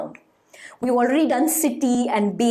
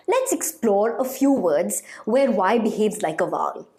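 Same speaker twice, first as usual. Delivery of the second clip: ordinary delivery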